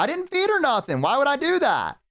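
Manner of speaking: innocent dad. Retold or imitated. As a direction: squeak out the denial like a guilty dad. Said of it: "He's like".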